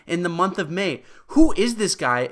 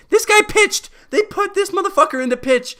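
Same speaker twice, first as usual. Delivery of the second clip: much higher, louder squeal of outrage; outraged disbelief; 4 dB louder than usual. Real, not acted. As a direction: shriek it in disbelief.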